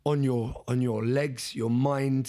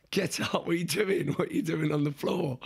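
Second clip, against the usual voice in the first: higher-pitched mock telling-off; imitated scolding fiancée; 4 semitones higher than usual. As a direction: lightly play an exasperated partner urging someone up. Voices fiancée scolding him on floor.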